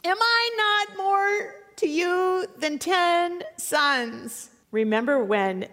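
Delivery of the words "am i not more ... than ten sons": high, slow, tender plea; comforting husband. Acted. Elkanah comforting his wife, high and drawn out. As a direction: stretch the question out gently to soothe her. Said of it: "He's trying to comfort her".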